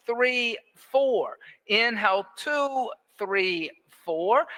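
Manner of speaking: evenly spaced, measured counting; metronome breath count. Real, not acted. Yoga teacher pacing the class's breathing, metronome count. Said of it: "that metronome breath count".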